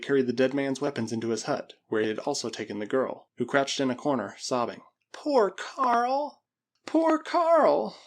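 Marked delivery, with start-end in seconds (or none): 5.1-8.0 very high, grieving moan. A narrator's reading. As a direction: lament him in a high moan. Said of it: "she moaned".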